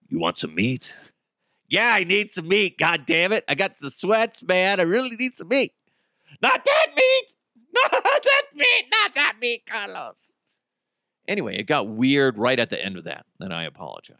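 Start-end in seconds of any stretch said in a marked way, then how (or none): 0.1-0.9 much lower and softer, a back-alley offer; shady dealer. Pretend. 1.7-5.7 higher, pleading craving for meat; desperate addict. Pretend. 6.4-10.3 horrified shriek. Pretend. Horrified at the wrong meat.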